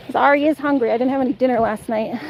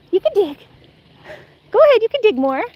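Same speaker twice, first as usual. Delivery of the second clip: encouraging; 8 semitones higher than usual. Real, not acted. Encouraging dog, high.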